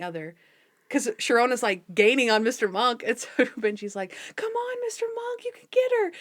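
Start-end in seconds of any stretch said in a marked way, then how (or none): 4.4-6.1 high excited cheer, imitating a boy; cheering kid. Retelling Benji rooting for Monk, high cheering kid voice.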